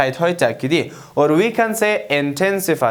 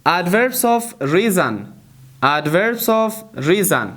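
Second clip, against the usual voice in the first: slow model reading; much slower than usual. Shown as a demonstration. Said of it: "teacher models slow English".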